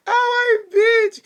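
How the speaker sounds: loud high mock yell; mock outburst. Retelling a reply to a friend, loud mock yell.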